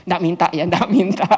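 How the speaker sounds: chuckling through the words; joking aside, breaking into a laugh; laughing disclaimer. Real, not acted. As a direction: say it with a real chuckle.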